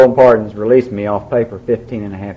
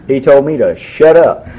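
ordinary talk

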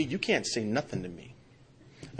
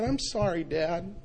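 higher-pitched, small imitation of a hurt girl; tearful child's apology; 6 semitones higher, slower than usual. Daughter apologizing to Dad after his yelling, mildly tearful. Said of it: "And she started crying".

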